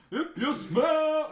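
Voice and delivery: mock the arena call while cracking up; The Rock's catchphrase. Retelling The Rock's entrance, laughing mock catchphrase. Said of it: "you hear the council line".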